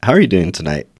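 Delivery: loud, upbeat host welcome, a bit fast; bright announcer-style introduction of the guest; show host intro. Acted. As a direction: open the show like a radio host.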